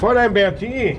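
put-on calling after a young woman departing. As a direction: call her name to catch her before she leaves. Detective calls after her in street.

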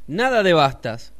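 grito agudo, mucho más fuerte; grito del Piojo. Acted. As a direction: grita rechazando. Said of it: "gritó el Piojo". (el Piojo grita furioso, agudo y fuerte)

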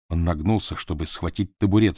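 plain reading voice

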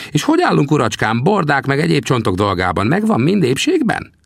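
put-on high, teasing little old man's voice; old trapper Sam. Sam teasingly asks the narrator about his bones, high old voice. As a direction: needle the greenhorn from a high perch. Sam's voice in dialogue.

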